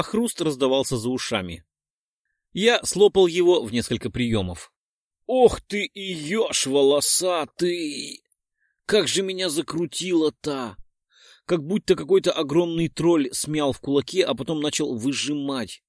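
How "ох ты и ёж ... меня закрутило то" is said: slow pained groan. Acted. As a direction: drag it out in agony. Hero seized by pain. (стон от боли)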